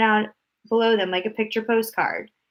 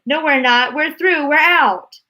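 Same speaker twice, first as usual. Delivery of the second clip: high, loud jubilant reply; triumphant Wonka; 7 semitones higher, 6 dB louder, slower than usual. A narrator's reading. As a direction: cheer as they burst through.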